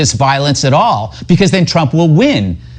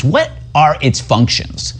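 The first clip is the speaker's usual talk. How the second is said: ordinary talk, nothing marked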